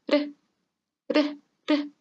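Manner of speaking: "re" with one tap of the tongue; three separate "re", each R tapped just once; soft Spanish R. Shown as a demonstration. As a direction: tap "re" lightly, no trill. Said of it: "En la R suave".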